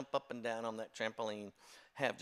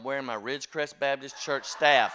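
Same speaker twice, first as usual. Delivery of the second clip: laughing out the punchline, louder; 3 semitones higher, 8 dB louder than usual. Real, not acted. Preacher revealing the church shirt, laughing loudly. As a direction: crack up as you deliver the reveal.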